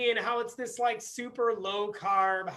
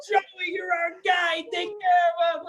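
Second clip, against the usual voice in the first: higher, louder, slow cheering goomba; loud delighted wiseguy; happy mobster customers; 8 semitones higher, 5 dB louder, slower than usual. Retold or imitated. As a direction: shout fond praise at Joey.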